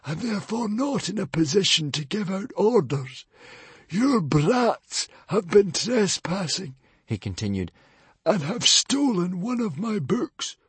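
high librarian voice, coolly pointed; a high, smug archer making his point. A narrator's reading. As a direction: point it out from behind the arrow. Librarian accuses.

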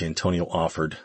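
ordinary delivery, nothing marked

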